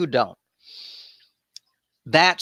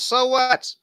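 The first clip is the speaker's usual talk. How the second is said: much higher put-on shrug; dismissive Jephthah; 12 semitones higher than usual. Jephthah brushing off the Ephraimites, high mock sass. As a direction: toss it off like a sassy brush-off. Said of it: "He says".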